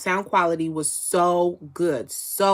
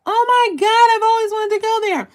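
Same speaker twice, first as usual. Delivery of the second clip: much higher and louder, delighted squeal; thrilled amazement; 8 dB louder than usual. Real, not acted. Host learning a viewer is in Scotland, squealing with delight. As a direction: gush at the news like it's the best thing ever. Viewer reveals living in Dubai.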